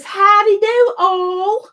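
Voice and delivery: loud, high Southern howdy; drawn-out put-on Southern greeting; generic Southern accent. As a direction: holler it slowly in mock Southern. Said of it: "doing a generic Southern thingy".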